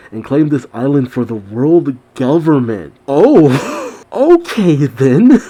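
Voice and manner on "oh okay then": slow, high okay then through a laugh; laughing disbelief. Real, not acted. Reacting to shocking manhunt plan.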